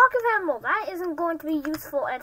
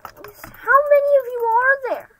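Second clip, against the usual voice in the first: higher, louder startled call; alarmed shout; 8 semitones higher, 10 dB louder than usual. Real, not acted. Spotting a crowd of mobs, startled shout. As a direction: blurt it out, caught off guard.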